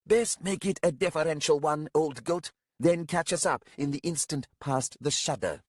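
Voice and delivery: high, haughty scolding; scornful young leader. A narrator's reading.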